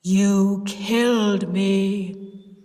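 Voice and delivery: loud, very slow ghostly accusation; deep voice. A narrator's reading. Sis as Henry accusing her aunt, deep voice. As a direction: intone each word, long and haunting. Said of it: "Sis said in the deep voice".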